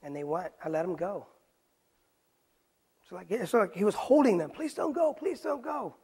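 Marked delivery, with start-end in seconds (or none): none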